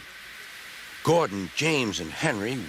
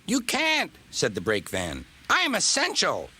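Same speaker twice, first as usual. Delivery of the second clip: much higher, cheeky little van voice; squeaky brake van; 8 semitones higher than usual. Acted. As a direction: pipe up smug and high.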